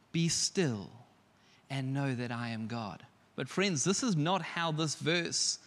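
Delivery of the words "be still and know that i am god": soft tranquil reading. Shown as a demonstration. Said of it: "because it just".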